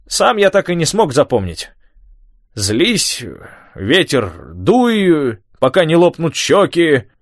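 high, lively recital with shivers; old man reciting. A narrator's reading. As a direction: declaim the verse through the cold. Tom reciting Shakespeare, freezing.

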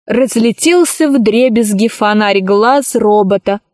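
usual speaking voice

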